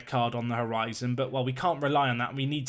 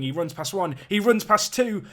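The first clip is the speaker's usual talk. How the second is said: rising from usual to high and loud; commentary surging higher and louder; building excitement; 6 semitones higher, 5 dB louder than usual. Real, not acted. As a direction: call the run with mounting excitement. Live commentary as attack builds.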